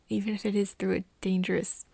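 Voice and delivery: usual speaking voice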